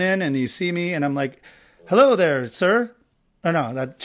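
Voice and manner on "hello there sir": bright-pitched, louder host's hostess act; pretend hostess greeting. Host pretending to be a hostess greeting the caller, playful role voice. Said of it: "she wouldn't say it like that".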